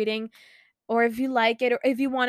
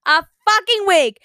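high, loud burst of fan excitement; excited shout; 9 semitones higher, 12 dB louder than usual. Real, not acted. Swiftie telling viewers the new album is a week away, shouting excitedly. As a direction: yell it like a thrilled fan.

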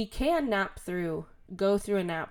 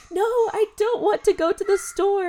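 put-on high, loud whiny toddler no; 12 semitones higher, 9 dB louder than usual. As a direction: whine it like a stubborn two-year-old. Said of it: "And he's like".

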